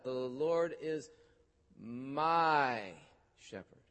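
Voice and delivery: slow "The Lord is", then "my" leaned on before "shepherd"; stress on my. Shown as a demonstration. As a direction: linger and press on the fourth word "my". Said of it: "I want you to emphasize that".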